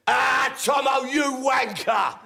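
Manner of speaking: loud, slow, mocking greeting down the phone; cheery shout. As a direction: bellow it like an old mate taking the piss. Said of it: "A voice on the other end goes".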